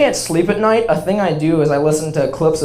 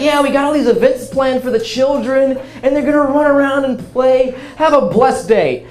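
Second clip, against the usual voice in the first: higher, sweet churchy lady; church lady voice; 6 semitones higher than usual. Retold or imitated. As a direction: chirp it like a cheerful church lady. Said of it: "she was the have a blessed day type".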